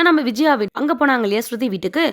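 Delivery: ordinary delivery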